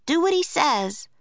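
put-on young girl Annie. A narrator's reading. Annie corrects the woman, firm girlish voice. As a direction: insist like a determined kid.

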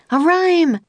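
very high urgent call; yell. A narrator's reading. Annie yelling to Jack for a rhyme, very high. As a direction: call out across the snow. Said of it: "yelled Annie".

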